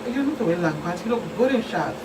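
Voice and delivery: ordinary delivery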